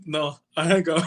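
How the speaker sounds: high playful denial; laughing refusal. Retold or imitated. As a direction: brush it off, half laughing. Retells refusing the call.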